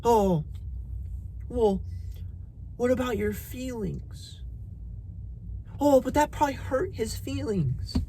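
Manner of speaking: parroting soft-hearted people sarcastically; mocking feelings talk. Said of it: "How they always tell you".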